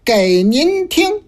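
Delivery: slow, measured recitation with long pauses; rhymed opening verse. Acted. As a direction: chant the opening couplets like a storyteller. Host chants opening verse slowly. (慢速吟诵开场词)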